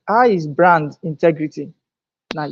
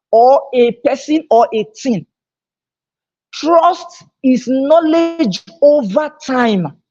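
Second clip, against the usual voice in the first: preaching; 7 semitones higher than usual. Acted. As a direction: lay out each word like a sermon.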